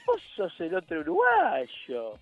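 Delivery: high, slow, drawling put-on voice; imitación del compañero. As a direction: stretch it out high, mimicking a colleague. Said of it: "habla así". (voz imitada, aguda y arrastrada)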